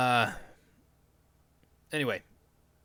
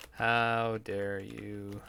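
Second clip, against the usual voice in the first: very slow meme quote; 2 semitones lower, much slower than usual. Retold or imitated. Mocking the viral quote, said very slowly. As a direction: drag out each word of the quote.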